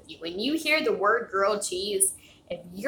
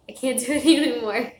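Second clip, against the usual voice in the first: louder, higher laugh through the words; 4 semitones higher, 8 dB louder than usual. Real, not acted. Streamer out of energy at stream's end, laughing. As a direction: break into a tired laugh mid-sentence.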